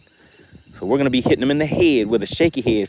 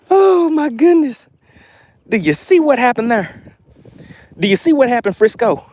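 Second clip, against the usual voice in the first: high, loud amazement at the catch; elated; 11 semitones higher, 4 dB louder than usual. Real, not acted. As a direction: stretch the first exclamation, then crow to the viewers. Angler has landed the big fish.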